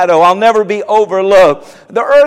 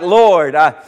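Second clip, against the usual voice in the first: hushed sample prayer to the Lord; demonstrating prayer; 4 semitones lower than usual. Shown as a demonstration. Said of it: "Things like".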